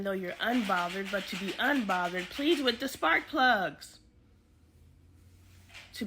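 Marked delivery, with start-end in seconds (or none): 2.3-4.0 loud slow sass; sassy dismissal. Real, not acted. Host mocking an unfollower, loud sass. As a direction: scoff it out slowly and loud.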